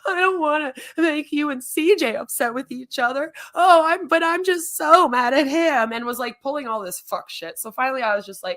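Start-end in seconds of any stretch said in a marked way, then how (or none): 0.1-5.9 high, loud, mock-tearful whine; mock crying whine. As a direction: imitate her fake sobbing plea. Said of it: "she was all like".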